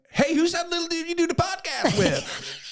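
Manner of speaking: high, slow American voice; retelling an American fan in an American accent. Retold or imitated. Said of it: "speaking to a Yank".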